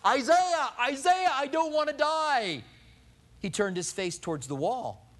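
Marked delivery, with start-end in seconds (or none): 0.0-2.6 high, loud pleading cry across the palace; frantic yell for the prophet, loud and high; desperate yelling. Pretend. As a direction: call out for him in panic. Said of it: "start yelling through the palace".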